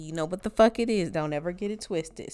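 ordinary talk

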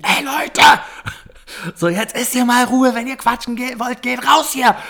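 high, loud yell; shouting at people talking in the cinema; retold shout. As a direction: yell at the noisy crowd to shut up. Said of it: "richtig übertrieben laut einfach geschrien".

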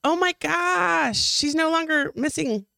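high, slow, drawn-out surprise; mock astonishment. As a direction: stretch it out in fake shock. Mock surprise at Jess arriving.

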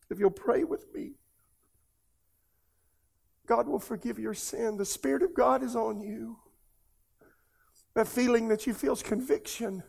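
pleading through tears, pitched high; tearful appeal. Real, not acted. Preacher urging viewers to pray with him, in real tears. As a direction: still choked up, urging gently with long pauses. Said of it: "That's why I'm crying".

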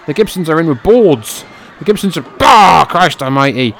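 loud, high, shocked play-by-play; excited shouting. Real, not acted. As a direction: yell it out in disbelief. Brawl with wooden boards erupts.

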